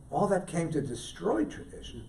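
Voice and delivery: soft, weighty restatement; quiet echo. Real, not acted. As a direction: let the repeat drop to a hush.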